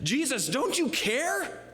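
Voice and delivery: high, drawn-out panicked mock wail; freaking out. Disciples crying to Jesus in the storm, freaking out. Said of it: "But they were freaking out".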